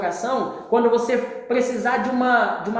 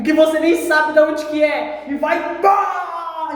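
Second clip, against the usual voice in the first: high, loud dramatic surge; explosive build-up; 7 semitones higher, 6 dB louder, slower than usual. Acted. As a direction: build to a huge shouted burst. Acts sudden voice bursting from depths.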